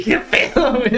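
high, breathy laugh through the words. Real, not acted. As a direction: giggle it out. Laughing reaction to the imitation.

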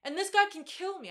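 usual speaking voice

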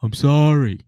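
high, loud mock apology; exaggerated husband's apology; Sean. Like, Sean going.

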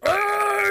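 high, loud war cry as Drax; battle scream. Retold or imitated. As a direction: shriek a war cry mid-leap. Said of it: "and he's like".

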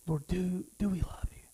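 breathy whisper to God; whispered prayer. Acted. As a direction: whisper the question to the Lord. Intimate question in prayer.

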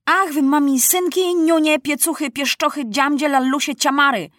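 high-pitched stream of insults; indignant, high-voiced tirade; soldierly scolding. A narrator's reading. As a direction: heap abuse on them like a trooper. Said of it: "sypał Maciuś całą swoją żołnierską uczoność".

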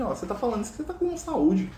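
high-pitched, quick blunt comeback; blunt retort. Retold or imitated. Sick man answering Francisco's cheering up, blunt. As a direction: throw it straight back at him. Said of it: "fala assim, na alta".

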